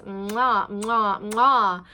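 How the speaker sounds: loud smacking air kisses; blown kisses. Real, not acted. Vlogger blowing kisses to viewers, loud smacking mwahs. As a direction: blow kisses to the audience.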